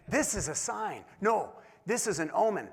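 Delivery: grand mock epiphany; mock dramatic. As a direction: overplay the cosmic revelation. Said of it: "Captain Obvious over here".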